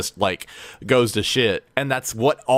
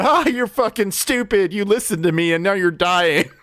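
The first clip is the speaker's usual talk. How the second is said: high mocking jeer; teen gloating at a poisoned friend; gloating taunt; 11 semitones higher, 3 dB louder than usual. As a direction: rub it in like a teasing kid. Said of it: "just chasing him around going".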